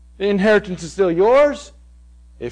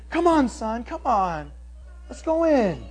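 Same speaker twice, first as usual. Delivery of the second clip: soft, coaxing plea; pleading; 8 dB quieter, slower than usual. Retold or imitated. Father softly pleading with his angry son. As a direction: gently coax the son inside. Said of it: "he's constantly".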